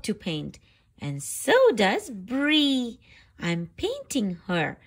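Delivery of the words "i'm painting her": plain reading voice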